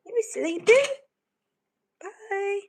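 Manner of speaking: high, slow sing-song pet voice. Real, not acted. Talking sweetly to the sleepy cat, high baby talk. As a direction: coo at a sleepy kitten.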